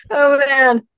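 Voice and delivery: real high, loud burst of laughter; helpless laughter, loud and high; laughing to tears. Real, not acted. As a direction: laugh hard, then a high "oh, man". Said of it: "That made me cry".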